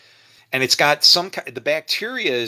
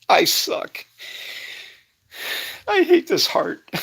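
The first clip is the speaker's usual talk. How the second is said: choked up, breaking into a high crying voice; fighting back real tears; slower than usual. Real, not acted. Retelling a stranger's story, tearing up for real. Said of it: "I'm gonna cry again".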